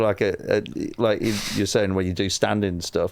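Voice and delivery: ordinary delivery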